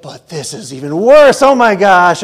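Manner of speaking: loud, hammed-up indignant cry; mock outrage. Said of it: "And it's like".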